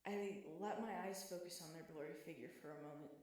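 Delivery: ordinary delivery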